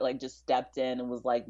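ordinary talk

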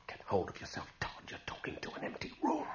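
calm yourself down under the breath; whispered self-talk. Acted. Todd talking himself down alone, whispering.

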